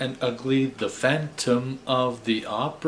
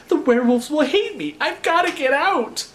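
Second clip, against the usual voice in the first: much higher, louder panic; panicked shout; 4 dB louder than usual. A narrator's reading. Young Drac panicking about a silver filling, high squeal. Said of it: "Drac wanted to shout".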